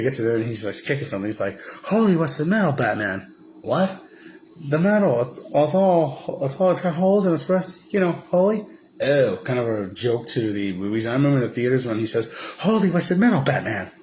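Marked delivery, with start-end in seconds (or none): 1.9-8.7 hammed-up eager sidekick; goofy Robin. Robin telling Batman about the rusty metal, hammed-up high voice. 12.6-13.9 put-on high, loud kid voice; hammed-up eager sidekick; goofy Robin. Robin telling Batman about the rusty metal, hammed-up high voice. Quoting line again.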